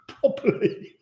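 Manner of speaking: breathy laughing repeat; mock the word through a laugh.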